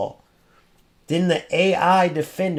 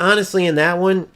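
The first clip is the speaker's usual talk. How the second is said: ordinary delivery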